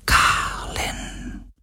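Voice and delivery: drawn-out, voiceless whisper. A narrator's reading. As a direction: whisper the name softly and slowly. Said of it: "flüsterte er".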